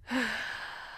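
long breathy exhale; weary sigh. Acted. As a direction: let out a long, exasperated sigh. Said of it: "And he sighs".

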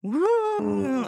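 high, drawn-out mock-ghost wail; spooky ghost woo. Host playing along with Halloween bit, spooky wail. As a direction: hoot it high like a pretend ghost. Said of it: "we're doing the spooky voice".

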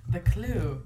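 low, slow, soft pronouncement; mock-solemn, hushed and slow; ominous game master. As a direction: deliver the clue gravely. Voicing the escape-room clue givers.

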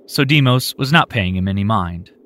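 usual speaking voice

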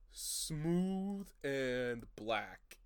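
very slow, lingering; hammed-up seductive drawl; mock sultry. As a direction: savor each word suggestively.

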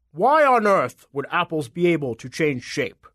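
slow, put-on giant voice; exasperated giant apple voice; scoffing giant. A narrator's reading. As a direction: scoff as an incredulous monster.

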